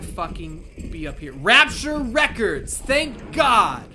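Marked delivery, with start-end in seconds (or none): 1.3-3.8 louder, higher joyful cry; excited relief. Real, not acted. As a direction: shout with relief at finally finding it. Finding the store, relief.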